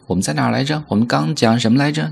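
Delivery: ordinary talk, nothing marked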